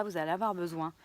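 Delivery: usual speaking voice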